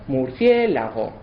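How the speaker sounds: murciélago said slowly, every syllable clear; slow syllable demo. Shown as a demonstration. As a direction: spell out murciélago by sound. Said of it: "por ejemplo".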